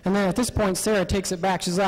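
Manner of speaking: ordinary talk, nothing marked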